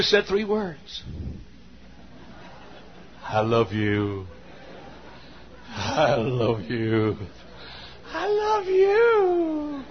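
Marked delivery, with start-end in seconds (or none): 3.2-7.2 very low, drawn-out crooning; mock lovestruck man. Mimics honeymooner, man's part. 8.1-9.8 very high, drawn-out falsetto; mock lovestruck woman. Mimics honeymooner, woman's part.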